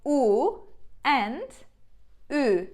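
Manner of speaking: "U" as a round back oo, then "ü" with rounded lips pushed forward; Turkish u and ü demo. Shown as a demonstration. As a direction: contrast "U" and "ü" clearly, loudly. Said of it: "let's compare".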